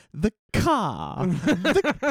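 high, posh the car; posh pronunciation. Shown as a demonstration. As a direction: say the car in a pretentious posh accent. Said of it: "How about this one?".

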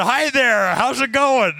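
high-pitched, mock-chirpy hello; cheery greeting. Traveler stepping across to grumpy seatmate, cheery retold greeting. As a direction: greet brightly, pitched well up.

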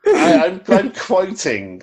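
high, slow, laughing protest. Real, not acted. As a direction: laugh out the words. Defending himself through laughter.

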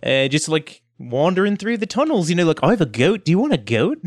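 casual, meandering character voice; lizardfolk Reese. Acted. Reese offers kobolds a goat, casual character accent. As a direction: offer a bargain offhandedly.

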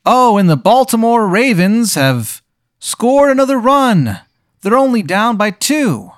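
high-pitched TV play-by-play voice; sports announcer. Acted. Game master as TV announcer calling a game, high sportscaster voice. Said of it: "You hear the announcer be like".

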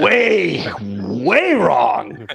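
each "way" loud and elongated; exaggerated stress. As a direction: lean hard on every "way". Comic repeat of how wrong.

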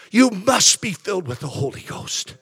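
ordinary delivery

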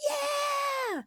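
put-on very high excited kids' cheer; kids cheering. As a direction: cheer like a room of happy kids. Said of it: "And they were like".

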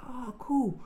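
very high imitated approval; friendly homeless guy. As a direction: coo it as an impressed stranger. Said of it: "there was some homeless guy there".

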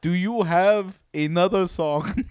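put-on half-hearted Indian accent; mock Indian accent. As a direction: ask the singer in a faked accent. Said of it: "Still Indian".

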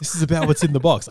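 high, loud and fast; exasperated shout. Retold or imitated. Viewer yelling at a dithering film character, exasperated shout.

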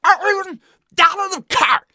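put-on furious high scream in Boston-or-Liverpool accent; screamed anger accent. A narrator's reading. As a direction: scream it, rage bending the vowels toward Boston or Liverpool. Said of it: "and screamed".

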